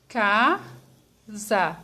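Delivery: "Casa" split as ca, za with a pause between; syllable by syllable. Shown as a demonstration. Teacher showing how to separate casa, syllable by syllable. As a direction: say "Casa" slowly, one syllable at a time. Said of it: "eu falo devagar".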